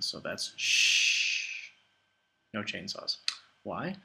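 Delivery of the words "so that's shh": drawn-out "shh", slow and hushed; long shh. Shown as a demonstration. Teacher demos the sheen sound as a hush, slow. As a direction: hold "shh" like hushing a class. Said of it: "makes the sh sound".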